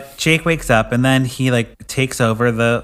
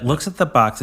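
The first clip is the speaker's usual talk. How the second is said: ordinary delivery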